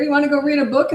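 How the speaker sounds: high-pitched cheery invitation. Shown as a demonstration. Parent inviting crying child to read, cheery. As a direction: offer it cheerily, untroubled by the crying. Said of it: "Just still have that cheery tone".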